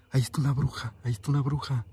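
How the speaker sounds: ordinary talk, nothing marked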